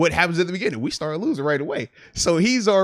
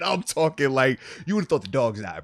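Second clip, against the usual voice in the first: ordinary delivery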